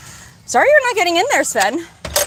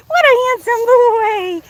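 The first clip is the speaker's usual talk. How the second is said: higher, louder, slower sing-song cooing; stronger pet talk; 8 semitones higher, 5 dB louder, slower than usual. Real, not acted. Owner gushing over her goat Trigger, exaggerated pet talk.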